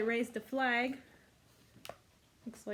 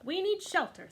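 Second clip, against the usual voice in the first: higher, louder urgent cat voice; tabby cat urging the others; 8 semitones higher, 3 dB louder than usual. Acted. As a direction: call the group to shelter. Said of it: "said the tabby".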